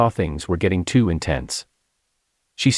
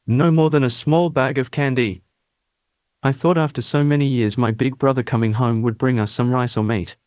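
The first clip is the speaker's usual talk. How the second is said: higher moaning voice; moaning complaint; 6 semitones higher than usual. Acted. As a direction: moan it like a hungry aunt.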